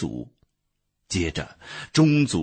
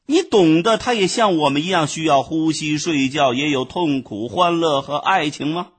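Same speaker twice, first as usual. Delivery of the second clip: higher, louder condescending lecture; lecturing bee; 8 semitones higher, 4 dB louder than usual. A narrator's reading. Bee lectures the ant scornfully about flowers. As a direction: lecture him like a fool. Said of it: "蜜蜂又接着说".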